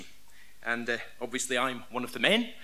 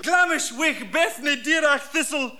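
mock Scottish gibberish; 9 semitones higher, 6 dB louder than usual.

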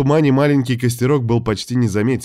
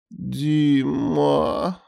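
drowsy, drawn-out murmur; sleepy drawl; 5 semitones higher than usual. Acted. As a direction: stretch the name out half asleep. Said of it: "сонно протянула та".

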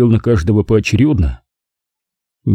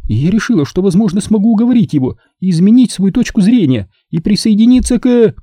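Zelda pleading; 10 semitones higher than usual. A narrator's reading.

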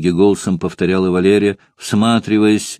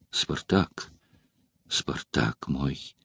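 soft, slow, lingering call of his name; a lover softly calling her beloved; tender call; 5 semitones lower, 11 dB quieter, much slower than usual. A narrator's reading. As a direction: breathe his name lovingly, very slowly. Valeria tenderly calling Spartacus.

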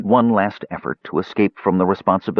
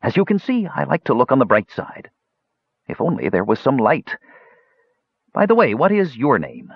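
high, chattering on without a break; rapid nonstop chatter, hollow and high; hollow prattle; 8 semitones higher than usual. Acted. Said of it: "the voice prattled on hollowly".

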